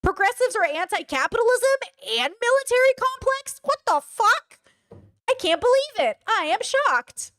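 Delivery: high, hammed-up mock shock; mock shocked Ethan. Streamer mocks Ethan's shock, high hammed-up voice. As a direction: play him stunned by his own words. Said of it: "he's like".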